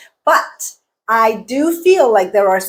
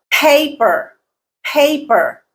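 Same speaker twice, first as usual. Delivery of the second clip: paper with PA high and strong, falling after; first-syllable stress; 5 semitones higher, slower than usual. Shown as a demonstration. Teacher showing learners word stress, paper stressed on PA. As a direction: punch PA in paper, soften the ending. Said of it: "Pay is the stressed syllable".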